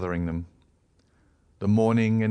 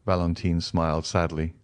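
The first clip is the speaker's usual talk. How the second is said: usual speaking voice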